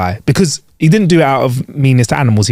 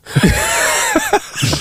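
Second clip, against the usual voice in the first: a laugh that jumps way up; high squeal of laughter; 5 dB quieter than usual. Real, not acted. Laughing at a shared joke about a mate, high squeal.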